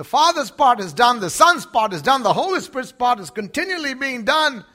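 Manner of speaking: high, loud triumphant proclamation; preaching at full force. Real, not acted. Preacher proclaiming to the church, loud and high.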